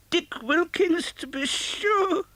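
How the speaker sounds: high, slow, fond recognition; fond old Scrooge. Acted. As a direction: savour the old name.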